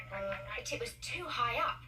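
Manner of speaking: ordinary delivery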